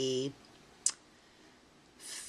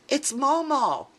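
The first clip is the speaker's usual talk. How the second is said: excited reveal; 10 semitones higher, 6 dB louder than usual. Acted. Reads the card aloud, gasp first.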